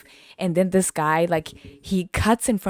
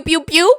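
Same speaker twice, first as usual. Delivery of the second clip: higher, louder pew pew; mock gun sounds; 11 semitones higher, 8 dB louder than usual. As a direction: fire off playful gunshot noises. Imitating gunshots.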